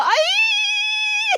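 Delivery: high squealing cry of fright; imitating a scared lady on a coaster; mock scared cry. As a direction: squeal like a terrified rider. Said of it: "she's all".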